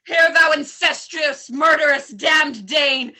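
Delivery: bellowing rage at the king; furious shout. Acted. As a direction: roar it with full fury. Hamlet stabs the king.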